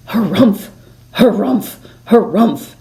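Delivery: huffy bird grumbling harumph; grumpy bird harumph. A narrator's reading. A bonga bird harumphs at the squirrels, huffy. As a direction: harrumph like an indignant bird. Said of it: "yelled a bonga bird".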